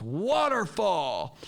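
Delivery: high, long drawn-out holler; imitated loud yell. Teacher calling two hundred teachers to order, imitated yell. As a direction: bellow it as a cue, stretching it out. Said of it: "she yells so loud".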